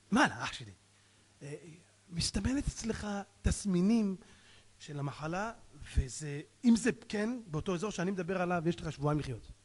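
hesitant doctor easing into a grave verdict. Acted. Doctor giving a patient two weeks to live, lightly acted doctor. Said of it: "אומר לרופא".

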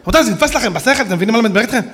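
ordinary talk, nothing marked

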